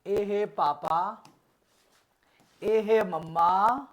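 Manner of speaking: put-on slow sing-song baby talk; lightly voiced parent cooing. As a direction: coax a toddler to say पापा. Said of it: "ऐसे कर देते हैं".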